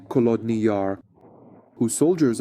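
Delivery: ordinary talk, nothing marked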